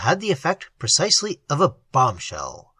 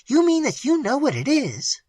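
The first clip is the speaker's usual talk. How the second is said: alarmed; 8 semitones higher than usual. A narrator's reading. Missus Maloney alarmed, questioning.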